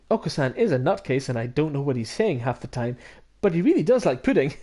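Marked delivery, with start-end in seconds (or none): none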